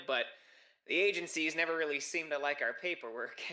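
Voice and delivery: put-on chatty, lilting Minnesotan accent. Chatty host tells a visitor about their month off, Minnesotan accent. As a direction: lay on the homely Minnesotan accent. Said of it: "a Minnesotan accent".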